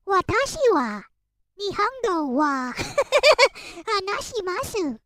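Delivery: mocking how Americans mangle Japanese; lightly voiced clumsy American Japanese; American accent in Japanese. Imitating Americans speaking Japanese, mild mock accent. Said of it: "it really does sound like".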